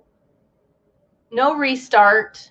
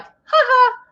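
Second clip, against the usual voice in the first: mock laugh; 13 semitones higher than usual. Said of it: "they're like".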